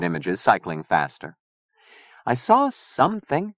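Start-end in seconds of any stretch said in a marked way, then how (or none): 2.2-3.5 high, slow admission; hesitant Chia, uneasy. A narrator's reading. Chia admitting she saw something, uneasy. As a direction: confess it hesitantly.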